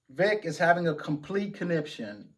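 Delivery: ordinary talk, nothing marked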